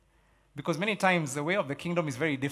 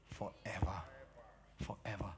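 quiet repeat; 8 semitones lower, 14 dB quieter than usual. Shown as a demonstration. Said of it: "somebody say it".

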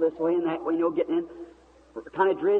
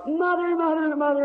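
mock scream, pitched way up; retold screaming; 12 semitones higher, 4 dB louder than usual. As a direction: call out high, imitating an overwhelmed cry. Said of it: "began screaming".

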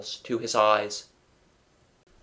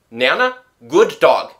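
loud, pitched-up call to a pet; coaxing the dog; 4 semitones higher, 9 dB louder than usual. A narrator's reading. Father calls and pats Nana, falsely friendly. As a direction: praise the dog a bit too brightly.

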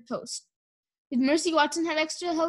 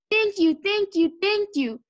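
high, effusive repeated thanks; gushing thanks; 7 semitones higher, 3 dB louder than usual. A narrator's reading. Mister Watson thankful Mercy is safe, gushing. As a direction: gush with overflowing relief.

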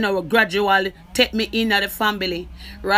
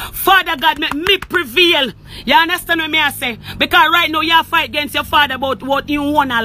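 higher, heated accusing voice; angry rant; 4 semitones higher than usual. Real, not acted. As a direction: lash out at him, voice pitched up and hard. Heated accusations at family member.

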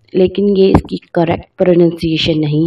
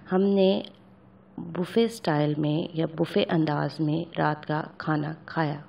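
softly trailing off; 3 semitones lower, 13 dB quieter than usual. Real, not acted. Translation trailing off.

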